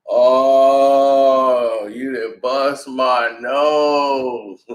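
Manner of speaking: long, drawn-out moan; mock pained wail. Friend reacting to his busted nose, mock drawn-out wail. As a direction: drag every word out in hurt disbelief.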